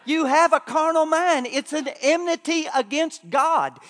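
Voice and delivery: loud, driving sermon cadence; preaching at full force. Acted. Preacher warning the church about the carnal mind, loud and driving. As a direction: hammer the warning home to the congregation.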